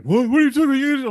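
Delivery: high, loud confused yelp; baffled outburst. Retold or imitated. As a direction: blurt it out totally confused. Young men confused by joke.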